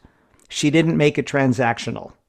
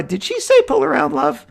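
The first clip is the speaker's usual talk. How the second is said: high, incredulous double-take; stunned question; 13 semitones higher than usual. Retold or imitated. Asking friends in the car, amazed and much higher in pitch. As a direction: raise the pitch in disbelief at the word love.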